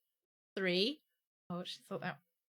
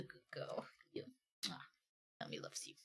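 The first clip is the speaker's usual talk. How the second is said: whispered to the pet; a hushed word to the pet; 9 semitones lower, 21 dB quieter than usual. Real, not acted. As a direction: breathe it softly to her. Talking softly to pet.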